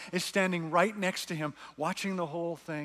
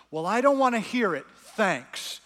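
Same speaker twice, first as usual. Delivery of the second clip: put-on boy refusing; 5 semitones higher, 6 dB louder than usual. A narrator's reading. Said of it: "said Diggory".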